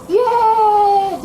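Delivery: happy cheer. Acted. Servant going home free of debt, happy cheer. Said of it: "dia pulang dengan senang".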